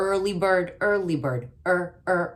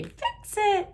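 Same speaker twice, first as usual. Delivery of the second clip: put-on high chirpy child voice; kids calling out. Teacher voicing pupils saying fix it, high. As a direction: call it out like an eager pupil. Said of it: "So I could hear you say".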